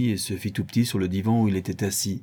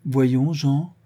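mild scolding, raised and affectionate; half-kind, half-scolding; 6 semitones higher, 5 dB louder than usual. A narrator's reading. Grandmother to her teasing grandson, half-scolding. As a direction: chide gently, with some push. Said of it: "à moitié aimable, à moitié grondeuse".

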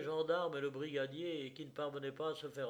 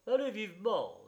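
raised, louder command; brisk order; 7 semitones higher, 6 dB louder than usual. A narrator's reading. The justice of the peace urges the bearer on, commanding. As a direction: urge them on sharply. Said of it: "dit le juge de paix au porteur".

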